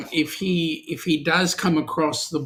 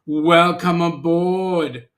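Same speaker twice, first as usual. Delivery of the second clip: slow welcome; 5 dB louder, much slower than usual. Real, not acted. Drawn-out host welcome.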